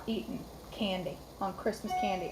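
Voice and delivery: normal talking voice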